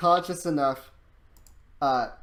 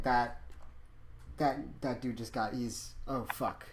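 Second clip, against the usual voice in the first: hushed dawning dread, much softer; muttered realization; 4 semitones lower, 10 dB quieter than usual. Acted. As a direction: drop into a low shocked mutter. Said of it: "but he is like".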